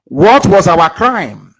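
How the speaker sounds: slow, loud and indignant; indignant demand. Real, not acted. Speaker demanding an answer from his audience, slow and loud with anger. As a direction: drag out each word in anger. Angry speech to his listeners.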